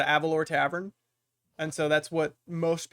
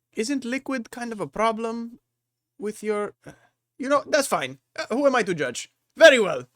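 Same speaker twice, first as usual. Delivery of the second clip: higher-pitched innkeeper voice; doubtful host deciding not to judge; puzzled, then obliging barkeeper; 7 semitones higher, 4 dB louder than usual. Acted. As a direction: question the guest, then give in with a loud finish.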